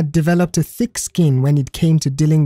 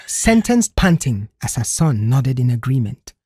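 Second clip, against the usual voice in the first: usual speaking voice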